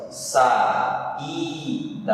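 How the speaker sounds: "saída" very slowly in syllables, sa-Í-da, leaning on the í; stressed syllable demo. Shown as a demonstration. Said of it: "ter sido a sílaba mais forte".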